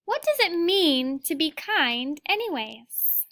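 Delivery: put-on reading a picture book aloud to children; gentle read-aloud storytelling; teacher reading to the class; story reading. A narrator's reading.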